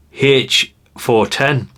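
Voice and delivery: ordinary talk, nothing marked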